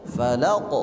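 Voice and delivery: "Falaq" with the q rebounding at once into a short o; bouncing qalqalah. Shown as a demonstration. Teacher shows correct way, "Falaq" with immediate echo. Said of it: "dia langsung memantul".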